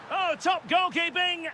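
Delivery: a high burst of praise for the save; sudden lift, admiring the keeper; excited commentary. Acted. Commentator praising a great save, high and excited.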